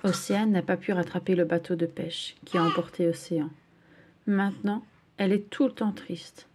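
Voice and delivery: plain reading voice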